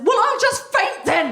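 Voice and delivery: high, melodramatic whimper; mock-faint martyr line; hammed-up high sulk; sulky whine. Retold petulant reply to husband.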